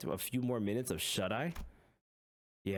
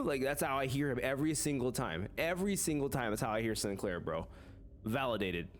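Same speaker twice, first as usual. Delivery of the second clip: ordinary talk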